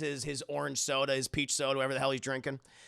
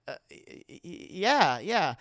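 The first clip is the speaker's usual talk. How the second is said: faint stammer before agreeing; acted flustered hesitation; nervous Emmett; slower than usual.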